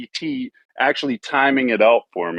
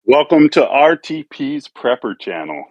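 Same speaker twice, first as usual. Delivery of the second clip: upbeat, raised-pitch channel welcome; happy; 5 semitones higher than usual. Real, not acted. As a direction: greet the audience with real happy energy. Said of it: "sounds so fucking happy right now".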